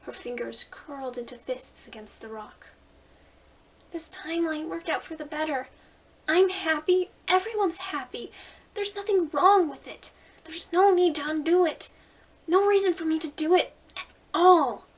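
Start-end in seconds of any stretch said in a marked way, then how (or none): 3.9-14.8 loud, high and insistent; defiant shout. Acted. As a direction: stand your ground, almost yelling. Frisk defiantly refusing the spirit.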